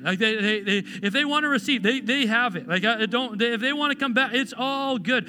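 insistent pulpit delivery; impassioned preaching. Acted. Preaches with rising intensity.

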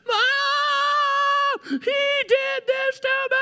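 put-on high, slow child wail; mock child whining to mom; whiny kid. Child running in, complaining.

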